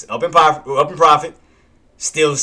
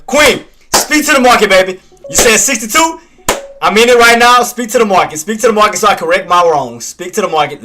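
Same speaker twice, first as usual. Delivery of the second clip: louder, higher urging at the market; pleading with the chart; 6 semitones higher, 6 dB louder than usual. Real, not acted. Trader urging the market on, loud pleading. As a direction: beg the market to move.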